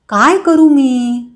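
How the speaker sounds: slow, drawn-out narrated fretting; mild storybook despair, slowly; worried lament. A narrator's reading. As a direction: stretch it out, at a loss. Cornered vendor frets to himself.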